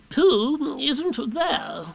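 high, very drawn-out owl; Owl reporting. A narrator's reading.

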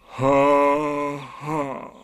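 two slow, joyless ha's; bitter. A narrator's reading. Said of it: "said Eeyore bitterly".

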